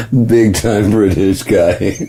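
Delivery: slow repeat through giggles; laughing. Real, not acted. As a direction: savor it slowly while cracking up. Repeats the line while cracking up.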